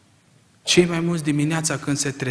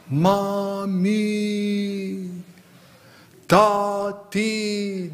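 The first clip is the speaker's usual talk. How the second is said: put-on child calling; 4 semitones higher, much slower than usual. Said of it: "Strigă cât îl țin puterile".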